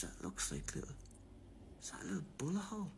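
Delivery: hushed, breathy realisation; shocked whisper. Retold or imitated. Cop spotting the bullet hole, whispering in shock. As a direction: whisper it as it dawns on him.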